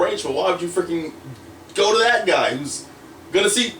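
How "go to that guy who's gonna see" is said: high, rapid, heated protest; exasperated rant. Retold or imitated. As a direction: build to an incredulous near-shout. Exasperated rant at missed opportunity.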